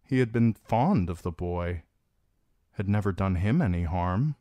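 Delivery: ordinary delivery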